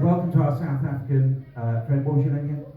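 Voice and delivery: normal talking voice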